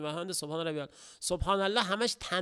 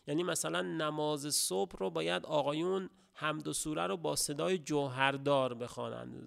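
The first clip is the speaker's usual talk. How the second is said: ordinary talk, nothing marked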